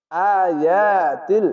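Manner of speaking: slow, drawn-out chanted recitation; Quran recitation. Shown as a demonstration. Teacher demonstrates for students: slow melodic recitation. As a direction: intone the word like tajweed reading. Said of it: "খাড়া জবর এক আলিফ টেনে পড়বেন".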